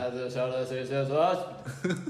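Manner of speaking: low, hearty trainer voice, sing-song ending; lightly hammed-up muscle instructor; macho YouTuber voice. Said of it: "で、その動画の人も".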